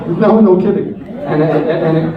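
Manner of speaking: laughing. Real, not acted. To the audience about the ironic note, laughing loudly. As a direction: laugh it out, amused.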